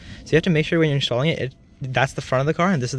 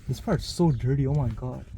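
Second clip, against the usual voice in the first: ordinary delivery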